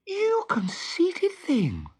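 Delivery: put-on high, slow, scornful girl's exclamation; indignant exclaim. A narrator's reading. Wendy indignant at Peter's boasting, slow high exclamation. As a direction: scold him for his vanity. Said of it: "exclaimed Wendy".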